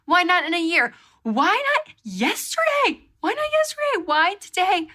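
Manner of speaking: high, whining why not yesterday; exasperated wail. Retold or imitated. Lamenting the bad luck.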